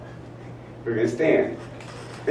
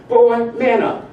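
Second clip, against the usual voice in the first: loud, higher scolding mother; stern mother; 10 semitones higher, 6 dB louder, slower than usual. Retold or imitated. Mother scolding her crying son, loud and stern. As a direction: snap it like a tough mother. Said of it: "And she said to me".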